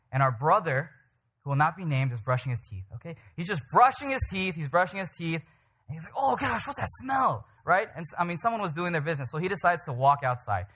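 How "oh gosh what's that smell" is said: high, drawn-out dismay at a smell; mock dismay, high and stretched out; disgusted reaction. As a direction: recoil from a stink, pitched up and slow. Acted reaction to bad smell.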